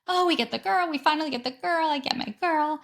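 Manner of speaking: put-on high, excited retelling of the squeal over "girl"; thrilled squeal. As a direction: relive the joy of finally getting a girl. Freaking out at pink glitter.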